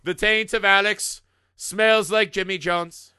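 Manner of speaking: hammed-up herald reading a scroll; town crier. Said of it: "I'll come out with a scroll like".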